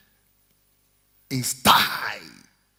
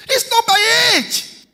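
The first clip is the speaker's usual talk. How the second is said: very high, loud, slow cry; soaring sermon line; preaching peak; 6 dB louder, slower than usual. Acted. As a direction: hold it out over the church.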